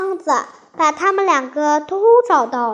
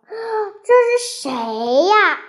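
curious, starting high then dropping; wondering who this newcomer is; puzzled; 6 semitones higher, slower than usual. A narrator's reading. Maomao asks about the unfamiliar little dog, puzzled. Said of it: "毛毛很奇怪".

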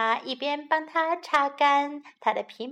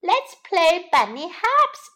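excited; 6 semitones higher, 7 dB louder, slower than usual. Acted. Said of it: "jumped up excitedly".

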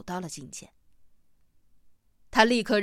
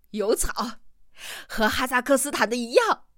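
excited cry, rising higher and louder; exclaiming; 3 semitones higher, 4 dB louder than usual. A narrator's reading. Hamsen slaps his thigh and joins in, exclaiming. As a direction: call back in eager agreement. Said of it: "跟着嚷道".

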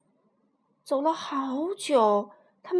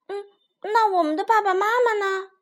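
worried question; 8 semitones higher, 5 dB louder than usual. Acted. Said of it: "卡梅利多担心起来".

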